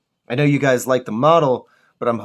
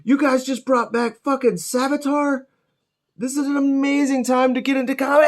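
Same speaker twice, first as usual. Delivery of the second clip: much higher, loud, gushing fanboy squeal; giddy fanboy; 12 semitones higher, 5 dB louder than usual. Retold or imitated. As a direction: rave like a thrilled comic fan. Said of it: "And I'm like".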